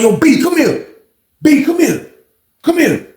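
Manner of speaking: high, insistent summons; retold calling over. Inmate summoning the little brother, insistent call. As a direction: call him over, pitched well up.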